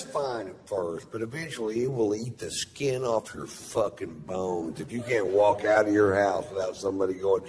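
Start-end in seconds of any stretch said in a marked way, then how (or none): none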